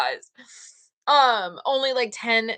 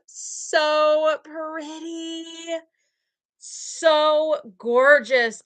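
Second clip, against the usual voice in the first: slow admiring; 6 semitones higher, much slower than usual. Real, not acted. Admiring the eyes.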